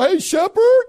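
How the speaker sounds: loud high hail; calling out. Retold or imitated. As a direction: yell to get his attention. Calling out to the shepherd.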